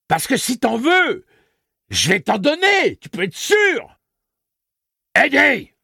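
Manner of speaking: high, loud, slow threatening yell; furious shouted order. Acted. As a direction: scream the threat and bark the name. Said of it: "Plus qu'un cri, c'était un ordre".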